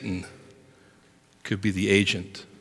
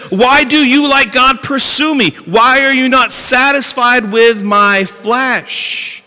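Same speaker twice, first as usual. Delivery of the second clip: anguished plea; 12 semitones higher, 9 dB louder than usual. Acted. Preacher voicing Job's plea.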